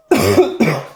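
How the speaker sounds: loud harsh cough; dry cough from a dusty throat; real cough. Real, not acted. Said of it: "I really have a bad cough today".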